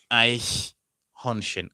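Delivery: Eichhörnchen said slowly and carefully; slow demo word. Shown as a demonstration. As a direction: model Eichhörnchen slowly for learners. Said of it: "teacher demonstrating pronunciation".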